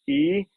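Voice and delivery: ई said high and held; vowel demo. Shown as a demonstration. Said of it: "तो आप कहेंगे ई".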